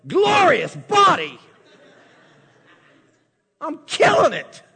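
shouted high and loud, hammed-up self-praise; bragging at your own reflection, a hammed-up shout; mock boastful shout. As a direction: crow at the mirror like a proud fool. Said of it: "looks in the mirror and goes".

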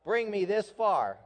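loud, rising call to the crowd; called out for repeating. Shown as a demonstration. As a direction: lead the congregation in it. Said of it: "So repeat that after me".

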